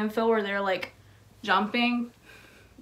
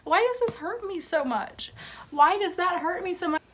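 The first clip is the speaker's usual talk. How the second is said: higher pitched, pained disbelief; real emotional ache; 8 semitones higher than usual. Real, not acted. Creator moved by her signed Phil book, aching and emotional. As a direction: ask it as if it truly hurts. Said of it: "this makes me want to cry".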